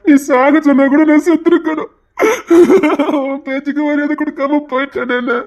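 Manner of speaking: wailing, sobbing apology; tearful remorse. Acted. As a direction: sob out bitter self-reproach. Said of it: "மாதிரி அழுதுட்டு".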